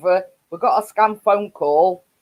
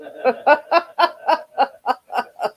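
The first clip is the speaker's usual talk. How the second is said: long high-pitched cackle; real cackle; 7 semitones higher than usual. Real, not acted. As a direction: keep cackling helplessly. Still laughing at the joke.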